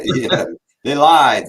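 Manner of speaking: loud laughing, slowed. Real, not acted.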